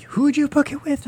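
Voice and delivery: very high mocking imitation; high-pitched dopey retold question; mock clueless staffer. Voicing the clueless staff again.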